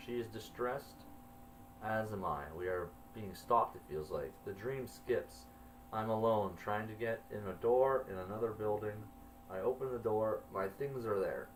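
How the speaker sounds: plain reading voice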